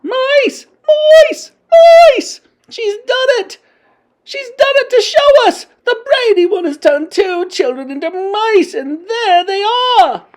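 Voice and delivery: high, loud shrieking witch voices; excited shrieking. A narrator's reading. Witches pointing at the platform, shrieking excitedly. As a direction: shriek with wild delight. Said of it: "crying out".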